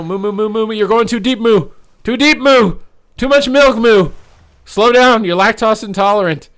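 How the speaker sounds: high, loud comic yelling; hammed-up panicked moo shouts; frantic mooing plea. Real, not acted. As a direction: beg the cow to pull back, half joking. Mock-pleading with cow player.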